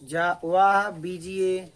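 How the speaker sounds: slow, loud classroom dictation; loud and measured; unhurried dictation. Shown as a demonstration. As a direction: draw each word out for students writing. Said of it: "हम आराम से बोलेंगे".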